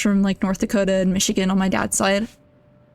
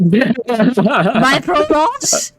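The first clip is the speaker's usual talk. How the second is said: excited outburst; 3 semitones higher, 4 dB louder, much slower than usual. Real, not acted. Laughing outburst at the mix-up.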